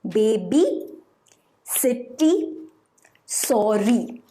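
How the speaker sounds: put-on "Baby, city, sorry" each broken in two beats; split into syllables. Shown as a demonstration. Teacher confirming three two syllable words, syllable by syllable. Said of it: "double syllable or two syllable".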